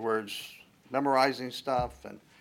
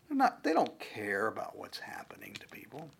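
whispering; 6 dB quieter than usual. Real, not acted. Earnest lowered aside.

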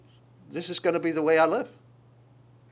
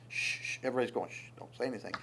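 soft shushing; hushed; 4 semitones lower, 12 dB quieter, much faster than usual. Retold or imitated. Soldiers hushing David, soft and hushed. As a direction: hush him down quietly.

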